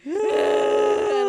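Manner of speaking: huge high gasping cry, slowed down; a loud gasp on seeing him in the hospital. Retold or imitated. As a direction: gasp out loud in shock at the sight. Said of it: "I'm like".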